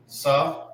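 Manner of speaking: "Sa" said alone; syllable demo. Shown as a demonstration. Teacher breaking the word down, isolated syllable. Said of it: "You have the".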